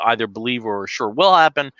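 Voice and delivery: normal talking voice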